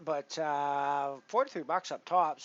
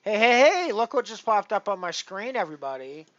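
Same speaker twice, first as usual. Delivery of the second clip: excited call out; 8 semitones higher, 13 dB louder than usual. Real, not acted. Excited streamer calling viewers.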